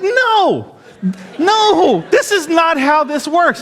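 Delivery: high, laughing disbelief; incredulous protest. Retold or imitated. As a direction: refuse it in shrill laughing disbelief. Protesting friend's advice, incredulous.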